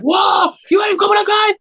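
put-on a starstruck kid blurting it out; excited teen fan. Neighbor kid realizing he is in Cobra Kai, high excited shout. He got, like, crazy.

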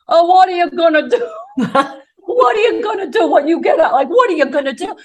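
put-on high, loud Italian dad accent, laughing; imitating her Italian father's accent. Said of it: "with his Italian accent".

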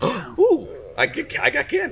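excited. Real, not acted. Finds candy in the pile.

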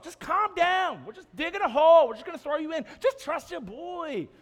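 high, loud, hammed-up reassurance; overbearing buddy insisting over the panic; insistent friend. Friend digging through roof to scared man, frantically reassuring.

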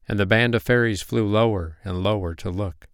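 usual speaking voice

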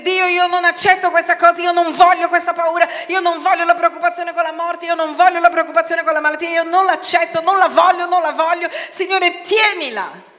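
high, loud, fast shouted refusals; forceful casting-off. Shown as a demonstration. Preacher shows how to throw worries onto God, forceful. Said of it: "Gettare vuol dire".

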